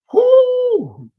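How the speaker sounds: high loud whoo of disbelief; high-pitched exclaimed whoo. Real, not acted. Reacting to his bad record.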